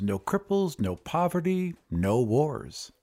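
ordinary delivery

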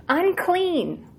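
leper calling out. Acted. Leper warning passers-by to keep away, restrained calling out. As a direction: call it out high, falling at the end. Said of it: "and call out".

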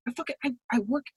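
usual speaking voice

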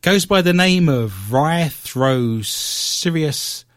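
very slow, sounded-out Latin name; careful attempt. Host takes a stab at a Latin name, slow and deliberate. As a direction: stretch each syllable, falling low at the end. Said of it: "I'm gonna give it a stab".

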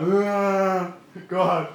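a lightly voiced drunk groaning; drunk at a bar. Elijah grumbling to God like a drunk at the bar, lightly voiced. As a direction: moan it like a man over his whiskey. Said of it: "like a guy at a bar hitting shots of whiskey".

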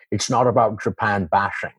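a hint of Scottish brogue, laid back; Sean Connery impression. Recalling a Connery TV ad, a light Scottish brogue. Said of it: "that Scottish brogue".

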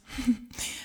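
a quick, soft falling laugh; a soft laugh before the teasing line; short soft laugh. A narrator's reading. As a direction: let out a little laugh. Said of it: "zeg lachend".

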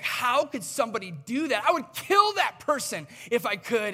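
King David shouting in outrage at the story; angry outburst. Retold or imitated. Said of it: "David is so angry".

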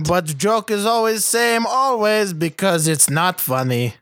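complaining mock-Russian voice; comic Russian-accented English. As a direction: grumble in a put-on Russian accent. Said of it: "antebellum Russia".

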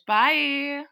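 normal talking voice

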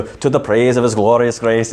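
lightly imitated Scottish brogue, as his wife does it; a hint of a Scottish brogue on the verse. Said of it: "in kind of a Scottish brogue".

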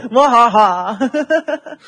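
slow, drawn-out evil "wahaha"; hammed-up villain cackle; mad scientist. Evil laugh after spider talk.

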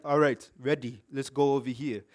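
a hint of a huge man giving orders; imitating hulking man.

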